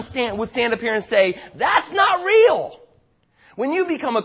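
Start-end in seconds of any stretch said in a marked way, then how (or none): 1.5-2.8 higher, louder and very slow, every word hammered; emphatic shout. Retold or imitated. John rejects Sunday-only faith with a slow ringing shout. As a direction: call it out like a prophet.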